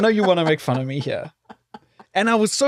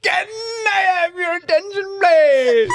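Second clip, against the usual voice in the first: hammed-up announcement; 14 semitones higher, much slower than usual. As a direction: belt it out like a waiter hyping a birthday. Announcement imitated again.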